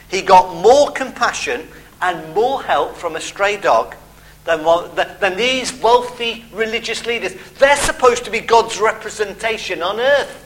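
ordinary talk

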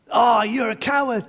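put-on boy angrily calls the echo a coward; angry taunt. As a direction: throw the word coward at him.

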